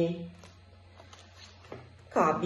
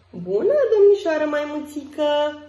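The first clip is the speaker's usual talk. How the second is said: cheerful greeting; 5 semitones higher, 4 dB louder than usual. A narrator's reading. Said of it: "spune Jake".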